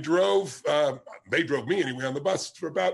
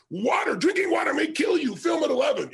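mock news teaser; 8 semitones higher than usual. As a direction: hype it like a scary evening news promo. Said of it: "That's why it says".

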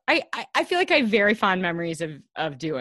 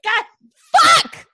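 mock frustrated shout; 10 dB louder than usual. Actor re-enacts failing at nineteen in group count, mock-frustrated shout. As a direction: curse rising to a high, loud burst. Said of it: "and you're like".

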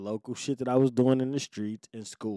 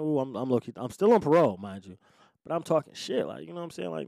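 ordinary talk

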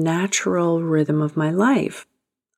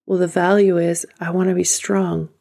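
usual speaking voice

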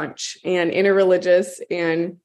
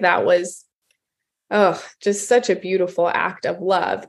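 ordinary delivery

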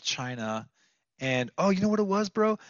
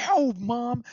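retold cry of alarm, high and louder; 9 semitones higher, 5 dB louder than usual. Son to mom at the stove fire, alarmed. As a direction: burst out high and loud, imitating your panic. Acted panic at kitchen fire.

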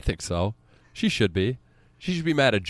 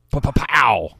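excited exclamation; 4 semitones higher, 8 dB louder, slower than usual. Real, not acted. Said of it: "I don't know why I said that".

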